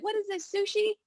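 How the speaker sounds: high teasing pun; joking tease. Retold or imitated. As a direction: toss off the joke in a high playful voice. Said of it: "my feng shui master always jokes".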